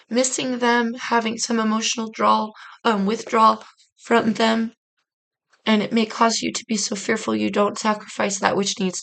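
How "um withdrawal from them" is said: normal talking voice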